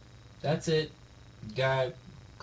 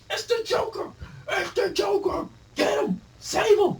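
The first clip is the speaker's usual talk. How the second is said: much higher crowd cry; crowd yelling. Retold or imitated. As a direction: squeal like a frantic crowd. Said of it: "Some guys are like".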